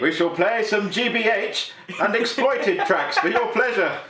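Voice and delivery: high, drawn-out grand appeal; mock-solemn bribe, slowly announced; Noah. Comic Noah bribing reluctant helpers with punk tracks, stately plea. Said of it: "if Noah had to bribe them".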